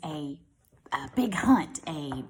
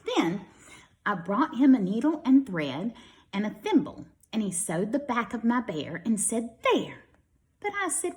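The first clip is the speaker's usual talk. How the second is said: lively storybook read-aloud; children's story voice for young listeners; 6 semitones higher, 3 dB louder than usual. A narrator's reading. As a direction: read it brightly to a class of kids. Reads picture book to children.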